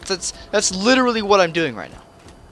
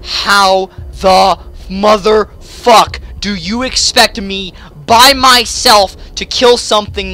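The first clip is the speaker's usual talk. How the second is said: slow, loud, word-by-word outrage; furious incredulity; 4 semitones higher, 6 dB louder, slower than usual. Real, not acted. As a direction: punch each word slowly and loudly. Furious rant at unfair boss.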